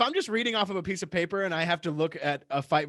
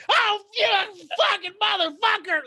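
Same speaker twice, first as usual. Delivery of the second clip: high, loud, slow panicked yell; terrified roller coaster scream; 5 dB louder, slower than usual. Retold or imitated. Uncle on a roller coaster drop, imitated terrified scream. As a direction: scream it like the coaster just dropped. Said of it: "he would sound like that".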